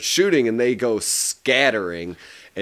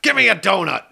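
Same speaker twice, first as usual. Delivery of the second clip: higher, put-on sergeant's demand; mock-gruff order for a snack; demanding cop; 7 semitones higher, 3 dB louder, faster than usual. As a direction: play the impatient police sergeant. Acts out sergeant demanding a donut.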